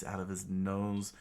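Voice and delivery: plain reading voice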